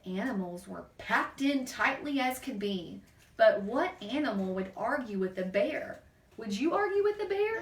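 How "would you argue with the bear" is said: playful question. Acted. Teasing question to child.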